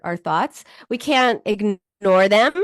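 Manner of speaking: ordinary talk, nothing marked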